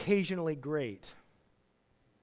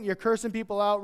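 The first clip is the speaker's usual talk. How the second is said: ordinary talk, nothing marked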